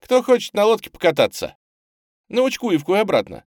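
high, loud, merry call; cheerful invitation. A narrator's reading. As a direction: invite a crowd of kids gaily. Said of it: "весело прозвучал голос Тамары".

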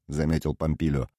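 ordinary delivery, nothing marked

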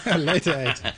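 laughingly mimicked posh announcer; old BBC announcer. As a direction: echo the vintage radio voice through a laugh. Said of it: "It was like".

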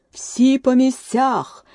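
slow, high booming command; the queen's slow, booming roar; thunderous shout. A narrator's reading. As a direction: thunder the order to the court. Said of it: "громовим голосом закричала королева".

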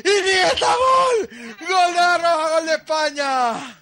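high ecstatic yelling; goal shout. Real, not acted. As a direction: belt out the goal call. Celebrating the goal. (grito de gol)

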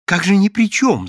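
high, fawning protest. A narrator's reading. Каин Александрович fawns before Прозрачный, high and ingratiating. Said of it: "залебезил Каин Александрович".

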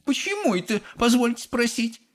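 very high-pitched haughty question; indignant challenge. A narrator's reading. Mother challenging Wonka, indignant. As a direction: demand a reason in a high woman's voice.

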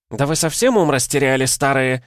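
high-pitched nurse's screech, moderately loud; возмущённый визг. A narrator's reading. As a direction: screech at the old women in outrage. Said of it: "завизжала медсестра". (визгливо и возмущённо, высоким голосом)

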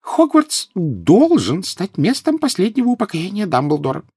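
very high, slow squeaky professor; high-pitched little declaration; Flitwick, squeaky. A narrator's reading. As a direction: pipe it out solemnly in a tiny high voice. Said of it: "пропищал профессор Флитвик".

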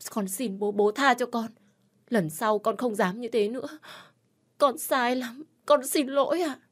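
high-pitched crying daughter begging forgiveness; tearful pleading. A narrator's reading. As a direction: plead with father through tears, pitched high. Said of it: "cô bắt đầu khóc lóc". (giọng con gái khóc lóc van xin)

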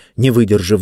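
usual speaking voice

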